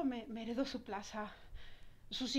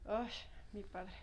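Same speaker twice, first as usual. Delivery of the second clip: usual speaking voice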